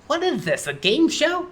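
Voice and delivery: high, incredulous squirrel voice; baffled. Acted. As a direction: question it high and bewildered. Conker baffled by game show.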